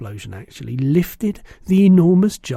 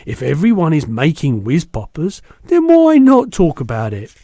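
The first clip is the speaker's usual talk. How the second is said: indignant giant; 2 semitones higher, 7 dB louder than usual. A narrator's reading. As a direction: push back with big outrage. Giant protests loudly.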